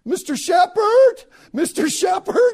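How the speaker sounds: shouted call across the field, high and slow. Retold or imitated. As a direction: hail someone far down the field.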